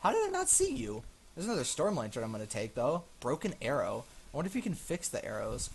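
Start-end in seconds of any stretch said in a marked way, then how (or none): 0.0-2.0 very high-pitched squeal of words; high incredulous exclamation; startled disbelief. Real, not acted. Just startled by unseen thing.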